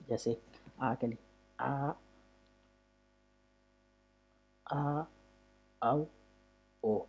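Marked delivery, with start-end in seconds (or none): 1.6-7.0 आ, आओ, ओ with the mouth closing step by step; closing vowels. Shown as a demonstration. Teacher models the vowel series for students. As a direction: model each vowel, mouth less open each time. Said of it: "इससे भी कम आपने मुंह खोलना है".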